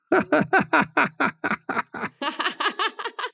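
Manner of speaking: high, thin jeering laughter; mocking crowd laugh. A narrator's reading. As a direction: laugh at him like a crowd of students. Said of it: "The students laughed".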